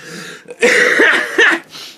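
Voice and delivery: airy high laugh; high breathy laugh. Real, not acted. Laughing at his own German joke, breathy high laugh.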